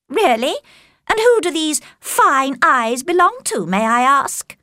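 high, drawn-out arch questioning; mocking Caroline. A narrator's reading. Caroline quizzing Darcy about the fine eyes, archly. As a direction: probe with affected curiosity.

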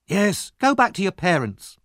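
high-pitched scolding onlooker. A narrator's reading. Another onlooker scolds the boy.

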